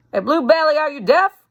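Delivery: a Southern soldier shouting over the water; mock call across the river. Reb calling across the river.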